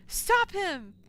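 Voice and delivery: sudden high yell of alarm; shocked shout. Real, not acted. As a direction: scream at him to stop. Streamer reacts to priest's plan.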